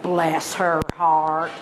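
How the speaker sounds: slow, low Southern drawl of bless her heart; Southern mother. Retold or imitated. Southern mother pitying the neighbor, slow Southern drawl. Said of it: "being a good Southern woman".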